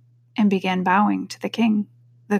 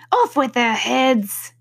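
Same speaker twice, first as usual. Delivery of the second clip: higher, drawn-out royal command; imperious queen; 4 semitones higher, slower than usual. A narrator's reading. Queen sentencing the gardeners, imperious. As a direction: slowly proclaim it like a queen.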